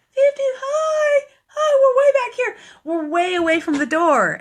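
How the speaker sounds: very high, loud far-away holler; mock calling out. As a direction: call across a long distance, hammed-up. Calling from far back from door.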